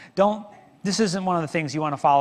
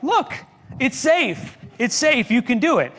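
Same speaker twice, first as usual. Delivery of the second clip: eager encouragement; 8 semitones higher than usual. Retold or imitated. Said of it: "And he was like".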